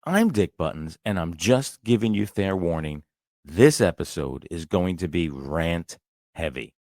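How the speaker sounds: low, soft and grumpy, already fed up; bad mood. Real, not acted. Host warning listeners of a rant, grumpy and low. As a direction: grumble it out, sour before the rant starts. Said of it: "as you could hear from the tone in my voice".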